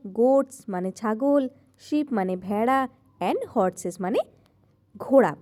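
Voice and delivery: normal talking voice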